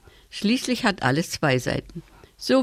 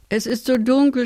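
a touch of sob, higher, understated; sobbing; 6 semitones higher than usual. A narrator's reading. Blind Tim to his parents, understated sobbing. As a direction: read it as a child near tears, lightly. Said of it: "schluchzt Tim".

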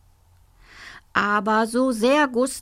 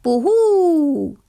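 higher, louder mock ghost "Buhu"; imitating the headmaster; 7 semitones higher, 6 dB louder than usual. Pupil Isabel mimics the headmaster's ghost howl, higher and louder. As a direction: ape the headmaster's spooky howl. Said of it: "machte Isabel den Direktor nach".

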